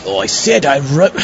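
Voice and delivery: exasperated outburst. Acted. Greeter snaps at a guest who can't grasp the name tag. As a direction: lose patience with the guest.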